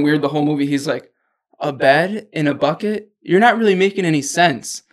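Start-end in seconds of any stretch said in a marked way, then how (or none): 1.6-4.8 retold skeptical response. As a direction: question it as if it makes no sense. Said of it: "a weird response".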